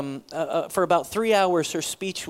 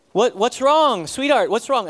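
loud, higher call from the shower; calling out worried; 6 semitones higher, 6 dB louder than usual. Retold or imitated. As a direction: shout over the water to her.

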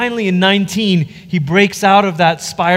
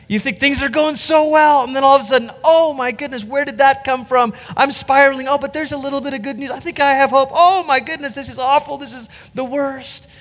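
higher, swinging voice from panic to hope and back; grieving person swinging; 8 semitones higher than usual. Retold or imitated. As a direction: act out grief lurching between despair and hope.

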